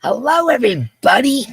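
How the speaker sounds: high, swooping hello; calling out a greeting. Real, not acted. Host says hello to everybody watching, calling out high.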